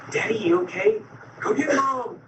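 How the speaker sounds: lightly imitated high-pitched exchange; retold family voices. Small daughter finding father in pain, retold family voices.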